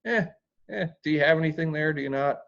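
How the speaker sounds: breezy teasing ask about the debt; lighthearted. Shown as a demonstration. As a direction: shrug it off with a casual eh. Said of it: "It's just lighthearted".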